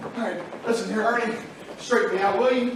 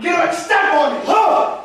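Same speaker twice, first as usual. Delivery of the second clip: loud, higher-pitched outburst; heated shout; 5 semitones higher, 8 dB louder than usual. Acted. George loses patience with the man who denies knowing him, shouting. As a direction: blow up at him, loud and high. Furious confrontation with a stranger.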